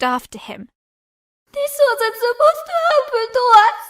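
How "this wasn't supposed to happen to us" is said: high, loud tearful lament; despairing cry. Acted. Terrified girl laments her fate to herself, crying. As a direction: grieve aloud.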